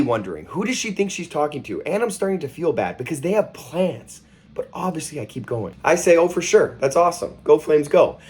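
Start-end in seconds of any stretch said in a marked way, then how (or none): 6.2-8.2 excited. Pretend. Fake cheering for rival team.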